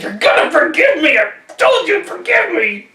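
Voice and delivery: high, loud frantic demand; desperate yell. Acted. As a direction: shout it as you grab him. Hester holds him, demands forgiveness.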